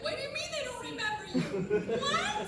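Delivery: high incredulous outburst; shocked disbelief. Retold or imitated. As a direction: react in stunned disbelief. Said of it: "and I'm like".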